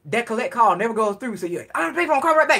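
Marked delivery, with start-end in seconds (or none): 1.7-2.6 said fast. Retold or imitated. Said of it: "you gotta say it fast".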